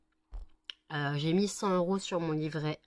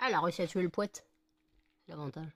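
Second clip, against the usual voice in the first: ordinary talk, nothing marked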